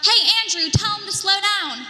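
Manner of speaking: loud call to a friend. Retold or imitated. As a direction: shout it across the crowd. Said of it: "I heard one of them yell out".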